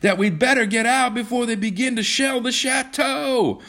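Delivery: high, sustained shout over the noise; high-pitched, insistent calling. A narrator's reading. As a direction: shout it across the bombardment. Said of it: "John shouted".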